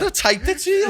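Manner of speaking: very high, loud squeal of protest; incredulous protest. Retold or imitated. Retelling his reply to a mate's text, high incredulous protest. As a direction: squeak it out, hammed-up disbelief. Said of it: "I said".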